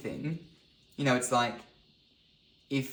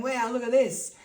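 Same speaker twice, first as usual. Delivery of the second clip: high, loud acted wonder; excited whoop of a kid on dad's shoulders; thrilled child; 12 semitones higher, 6 dB louder than usual. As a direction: exclaim in delight to your dad. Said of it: "and you're going".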